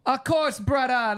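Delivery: high, exuberant Jamaican voice; Jamaican accent. Retold or imitated. Retelling the AI's reply to Mo, mock Jamaican accent. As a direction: imitate a Jamaican accent with gusto. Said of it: "speak back to me in Jamaican".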